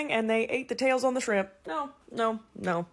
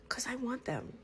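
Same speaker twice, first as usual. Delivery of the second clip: whisper; 2 semitones higher, 13 dB quieter than usual. Real, not acted. Whispered confession.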